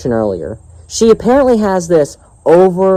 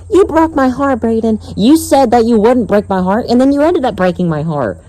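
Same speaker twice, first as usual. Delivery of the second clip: higher, whiny imitation of a girl; lightly mocked girl; 7 semitones higher than usual. Vlogger imitating girl accusing him of heartbreak, light mockery. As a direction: mimic her complaint in a lightly raised voice. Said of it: "that's another thing she was saying".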